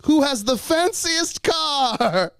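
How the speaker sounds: high, slow, drawn-out call; retold yell. At the rental car counter, he calls out in a high, slow, drawn-out yell. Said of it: "and I just yell".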